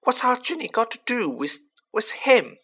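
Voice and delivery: high, pleading mother's voice; frightened mother demanding answers; anxious mother. Acted. Worried mother asks.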